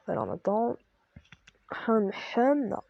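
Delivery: real sore throat, throat clearing, speaking gently. Real, not acted. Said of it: "j'ai mal à la gorge. C'est pour ça que je parle un peu doucement". (voix de quelqu'un qui a mal à la gorge)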